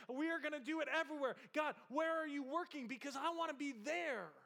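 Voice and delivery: loud, high and fast, preaching at full force; fervent shout. Acted. Preacher urging the church to seek God, shouting. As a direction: cry it out to the congregation.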